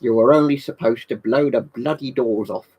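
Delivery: mock Cockney film-star impression; Michael Caine. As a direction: deliver it as a Cockney movie quote. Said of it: "I probably can't do Cockney".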